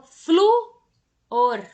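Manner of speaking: fluor split in two, FLU loud and drawn out; teacher's slow syllable-by-syllable fluor, hitting FLU; stress on the first syllable. Shown as a demonstration. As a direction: punch a long FLU in fluor, then a smaller OR. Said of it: "como é que eu leio, que eu falo".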